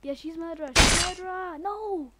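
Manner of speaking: rising panicked shout she's murderer; startled shout. Real, not acted. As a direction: yell it as she catches you. Caught by the murderer.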